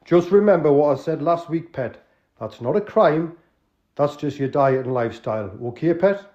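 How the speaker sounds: put-on Geordie accent. Tony checking the crooks have tea, Geordie. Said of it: "an authentic, uh, Northerner".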